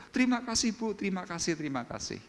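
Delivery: a grateful beggar repeating "terima kasih" high and fading; effusive thanks. Acted. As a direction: gush "terima kasih" repeatedly, high then dying away.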